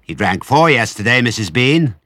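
high-volume servant's call; woman shouting. A narrator's reading. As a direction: holler it back up the stairs. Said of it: "the woman shouted".

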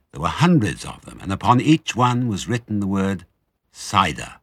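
plain reading voice

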